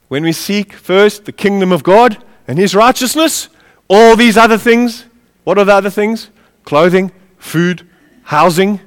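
ordinary delivery

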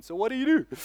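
high, bright-pitched asking voice; lightly acted eager question; playful high query; curious question. Retelling his own question to priest.

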